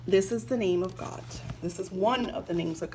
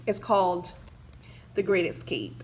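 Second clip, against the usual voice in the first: ordinary talk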